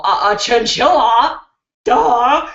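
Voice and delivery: ordinary talk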